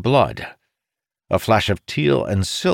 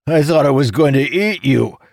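higher, louder excited declaration; character voice; 7 semitones higher, 5 dB louder than usual. A narrator's reading. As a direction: announce it breathlessly mid-battle. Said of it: "Jacob declared".

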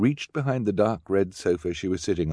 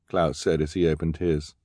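plain reading voice

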